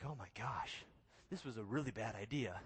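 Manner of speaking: low, soft mock dread; understated "what have I done" realisation; sinking dread. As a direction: murmur it to yourself in regret. Retold dread standing on edge.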